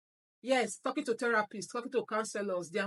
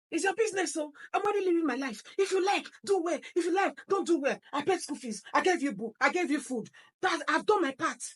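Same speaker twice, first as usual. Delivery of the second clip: dismissive parent; 7 semitones higher than usual. Shown as a demonstration. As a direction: toss it off like you've done your bit. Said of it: "Or it is".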